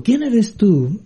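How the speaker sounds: lightly acted curious boy, pitched high. Boy questions the unknown voice, mildly acted curiosity. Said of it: "Con curiosidad, pues, el niño dijo".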